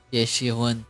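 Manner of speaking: ordinary talk, nothing marked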